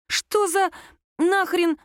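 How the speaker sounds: mock-outraged disbelief, pitched high; exasperated. A narrator's reading. Lera scoffing at her friends' argument, exasperated. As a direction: break the words apart in exasperation. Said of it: "закатила глаза Лера".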